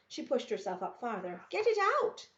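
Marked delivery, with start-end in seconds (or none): 1.5-2.3 sudden high, loud alarmed cry; urgent shout. Acted. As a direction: yell it in panic at the girl. Alarmed at chisel in fire.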